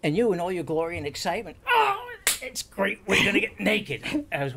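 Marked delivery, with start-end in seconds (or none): 1.7-4.3 high, slow, gushing mimic of his partner; mock excited partner. Mocking his partner's delight at getting naked, high and slow. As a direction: ham up his partner's glee.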